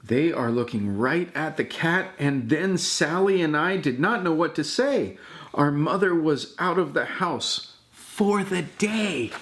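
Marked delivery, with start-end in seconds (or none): none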